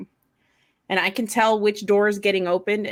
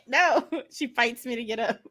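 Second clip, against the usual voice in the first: normal talking voice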